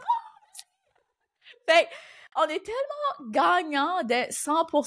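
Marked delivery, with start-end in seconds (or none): 0.0-0.6 delighted squeal. Retold or imitated. Podcaster reacting to clients who love her accent, delighted squeal.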